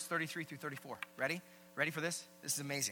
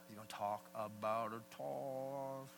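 mock grumbling listener; 3 semitones lower, slower than usual. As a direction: stretch "tithe" out long in mock dread. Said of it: "some of you are like".

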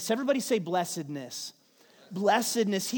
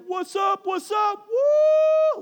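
high, loud gloating shout; hammed-up kid's victory whoop; gloating taunt; 13 semitones higher, 9 dB louder, much slower than usual. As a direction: crow over the beaten kid like a seven-year-old. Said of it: "and he's like".

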